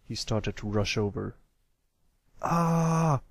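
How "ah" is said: high startled yelp; shouted "Ah". A narrator's reading. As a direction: yelp in a raised young voice. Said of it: "the brown colt shouted".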